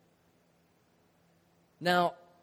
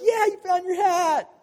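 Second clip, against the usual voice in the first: high and loud cheer; 13 semitones higher, 7 dB louder than usual. Retold or imitated. Friends cheer when he finds his lost hat, loud. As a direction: celebrate the find. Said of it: "we were like".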